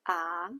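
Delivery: "ah" with a rising pitch; second tone. Shown as a demonstration. Teacher demonstrating Chinese second tone, rising pitch. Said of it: "The second tone is a rising pitch".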